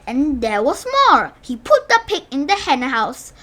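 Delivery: plain reading voice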